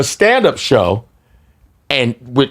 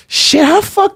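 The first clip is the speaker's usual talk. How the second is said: incredulous squeak; slower than usual. Retold or imitated. Stunned they know him.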